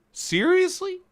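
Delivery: high incredulous question; surprised. Real, not acted. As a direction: sound truly surprised. Disbelief at search result.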